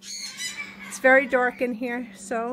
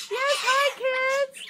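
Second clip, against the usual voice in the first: much higher, sing-song hello to the birds; high pet greeting; 9 semitones higher, much slower than usual. Real, not acted.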